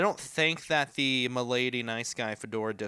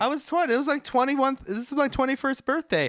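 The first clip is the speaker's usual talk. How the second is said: high mock protest; 11 semitones higher than usual. Mock defensive about his age.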